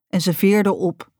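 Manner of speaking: ordinary delivery, nothing marked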